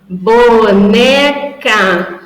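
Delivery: syllabified demo. Shown as a demonstration. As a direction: say it to a child, piece by piece. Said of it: "eu vou falar pra ela".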